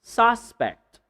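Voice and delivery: SUSpect, high punched SUS with a full vowel; stress on first syllable. Shown as a demonstration. Teacher models the noun, first-syllable stress. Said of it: "if you're talking about the noun".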